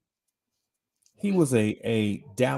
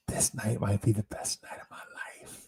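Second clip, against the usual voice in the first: hushed whisper to himself; awed little whisper; whispered thought; 3 semitones lower, 8 dB quieter than usual. Retold or imitated. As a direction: breathe it like a secret hope. Excited thought told to self.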